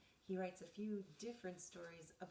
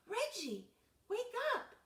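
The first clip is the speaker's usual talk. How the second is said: loud, high call; calling to wake; 12 dB louder than usual. Acted. Trying to wake sleeping Reggie, loud call. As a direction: call him awake.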